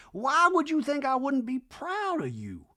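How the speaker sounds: high, slow hammed-up Reba; lofty slow mock-mom rant. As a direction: drone it like a grand old dame. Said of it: "Reba in scene".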